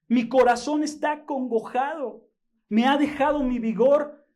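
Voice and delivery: high, loud, fervent preaching; preaching at full force. Acted. Pastor telling brothers David was sick, full-force preaching. Reads verse then preaches.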